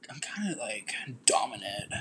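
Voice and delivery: barely voiced, very soft admission; hushed admission. Real, not acted. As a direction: drop to a whisper. Hushed admission of being dominant.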